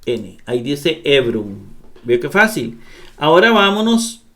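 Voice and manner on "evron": "Ebron" said EV-ron, diphthong running into R; Greek word demonstration. Shown as a demonstration. Teacher puts the letters together for learners, demonstrating "Ebron". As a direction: model "Ebron" as EV joined to R, O, N. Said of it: "La Ro suena como R".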